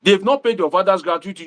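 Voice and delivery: ordinary delivery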